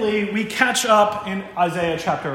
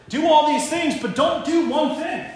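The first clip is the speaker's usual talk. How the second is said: higher-pitched, insistent acted command; emphatic instruction; 9 semitones higher, 4 dB louder than usual. God teaching people how to live, insistent. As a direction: lay down the rule, pitched up.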